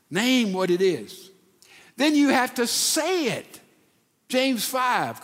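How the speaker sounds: ordinary delivery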